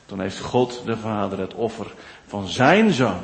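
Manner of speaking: ordinary talk, nothing marked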